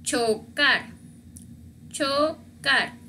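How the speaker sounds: chocar with a crisp "tch", syllables separated; CH sound. Shown as a demonstration. Teacher models C H examples, chocar syllable by syllable. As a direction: split chocar into cho and car. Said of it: "algunos ejemplos con C H".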